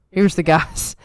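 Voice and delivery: usual speaking voice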